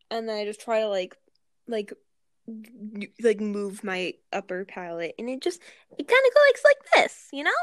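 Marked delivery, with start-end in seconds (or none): 5.2-7.3 high piercing shriek from a closed throat; shrill shriek. Shown as a demonstration. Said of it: "that was just kind of a shriek".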